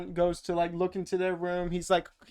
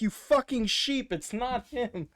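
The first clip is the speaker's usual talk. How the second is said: mock angry; 6 semitones higher, slower than usual. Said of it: "even Lori's like".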